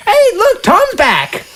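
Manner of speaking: put-on very high child's voice; excited little boy. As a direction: call out happily like a ten-year-old. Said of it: "the little boy with them".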